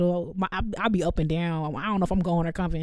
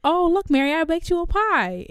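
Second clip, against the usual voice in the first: sweet mock Joseph; 9 semitones higher, 4 dB louder than usual. Joseph offering the pie.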